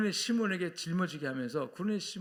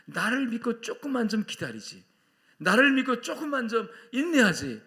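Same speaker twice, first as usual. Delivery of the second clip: raised, pleading reproach; pleading lament; 6 semitones higher, 7 dB louder than usual. Acted. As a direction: plead with regret, voice climbing high. God pleading with those who left. (안타깝게 애원하듯)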